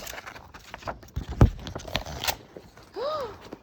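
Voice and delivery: long breathy wonder gasp; a long awed gasp; whispered gasp. Pretend. Mother reading to her child, long unvoiced gasp of wonder.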